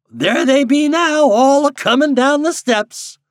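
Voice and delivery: high, loud, drawn-out country voice; amused backwoods guide, pitched well up; chuckling old guide. A narrator's reading. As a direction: call it out slowly in rustic glee.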